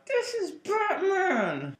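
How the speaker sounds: very high, drawn-out mock cry; excited proclamation. Fan showing the comic to friends, high excited cry. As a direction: squeal it slowly, high above your normal voice.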